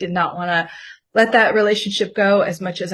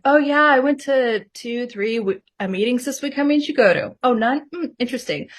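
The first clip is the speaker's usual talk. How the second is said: higher, falsely casual self-parody; mock manipulative; 5 semitones higher than usual. Woman mimicking how she needled her boyfriend, mock manipulative. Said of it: "how manipulative that was".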